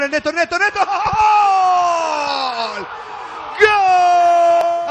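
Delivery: laughing, shouting the goal. Real, not acted.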